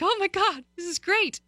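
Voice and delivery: high-pitched mock delight; retold elated relief. Retells her relief at reprieve.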